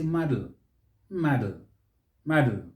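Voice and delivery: "model" as MAH-dl; American model. Shown as a demonstration. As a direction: open first vowel in "model", no vowel before the l. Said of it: "The Americans may say".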